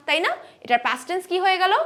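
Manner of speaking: usual speaking voice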